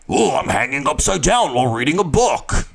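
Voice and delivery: hammed-up swooping character voice; mutant impression. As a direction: play a mutant giving away too many clues. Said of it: "I don't want to be like".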